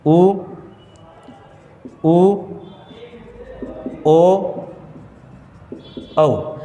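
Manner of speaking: उ, ऊ, ओ, औ each sounded alone with rounded lips; rounded-lip vowel demo. Shown as a demonstration. Teacher showing students lip rounding, isolated rounded vowels उ, ऊ, ओ, औ. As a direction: round the lips for उ, ऊ, ओ, औ, pausing between them. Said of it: "आप बोलकर या उच्चारण करके देख सकते हैं".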